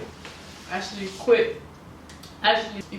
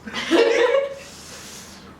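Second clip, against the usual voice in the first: high squealing laugh. Real, not acted.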